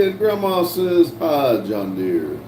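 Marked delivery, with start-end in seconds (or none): 1.2-2.4 very low, slow hi; mock deep greeting. Reading Grandma's hi to John Deere, mock deep voice. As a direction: stretch it out deep down in the voice.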